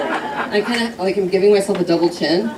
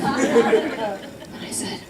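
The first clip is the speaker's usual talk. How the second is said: breathy laughing aside; 2 semitones higher, 7 dB quieter, much slower than usual. Real, not acted. Narration dissolving into laughter.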